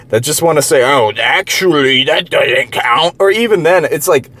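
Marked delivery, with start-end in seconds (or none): 0.8-3.1 slow, drawn-out know-it-all parody; exaggerated, dragging "actually" voice; mock neckbeard. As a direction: stretch every word mockingly, like a pedantic neckbeard. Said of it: "the fucking neckbeard type guys".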